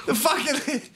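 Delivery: ordinary talk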